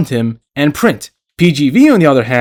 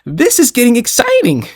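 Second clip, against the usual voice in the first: high-pitched excited anime hero, badly faked; amateur high-voiced hero impression; Goku; 10 semitones higher than usual. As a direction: attempt an excited cartoon hero catchphrase, pitched way up. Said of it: "Can't do a Goku impression".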